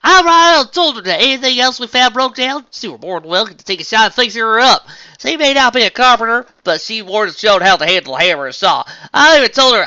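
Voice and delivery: put-on loud, high country-accent boast; proud country accent. A narrator's reading. Farm pony bragging about her sister, excited country accent. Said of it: "falling back into her accent".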